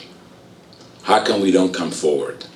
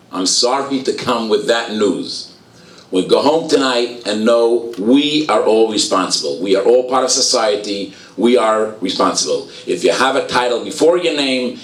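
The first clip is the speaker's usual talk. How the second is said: much higher, rising preacher's cry; fervent sermon building to its point; impassioned preaching; 5 semitones higher than usual. Acted. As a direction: preach it to the whole hall. Preaching at full force.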